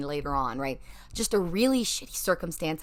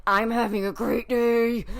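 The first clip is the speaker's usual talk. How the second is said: annoyingly cheerful; 4 semitones higher, 4 dB louder than usual. Pretend. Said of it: "in a really annoying way".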